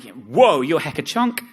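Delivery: loud, high "whoa" of disbelief; mock shocked partner. Quoting his ex seeing his weight, mock shocked. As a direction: recoil loudly in mock amazement. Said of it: "She was like".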